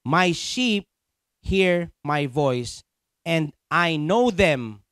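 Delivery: very slow, phrase by phrase; emphatic repetition. Acted. Preacher refocusing congregation on verse twenty-seven, slow emphatic repetition. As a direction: set each phrase apart with weighty pauses. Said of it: "Ulitin ko".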